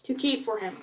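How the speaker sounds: ordinary delivery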